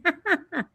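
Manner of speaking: short, high giggle; real light laugh at yourself; quick high-pitched chuckle, falling away; self-deprecating laugh. Real, not acted. Laughs at being old abroad.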